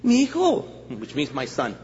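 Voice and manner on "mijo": higher-pitched mom calling her son; acted motherly address; doubting mother. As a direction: call out like a puzzled mama. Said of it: "she comes to our church and she says".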